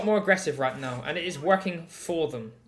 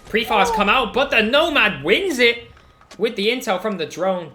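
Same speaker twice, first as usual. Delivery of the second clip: rising, louder call of the fight; excited play-by-play; 5 semitones higher, 7 dB louder than usual. Real, not acted. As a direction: call the gunfight with building hype. Caster calls a gunfight.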